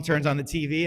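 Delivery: ordinary talk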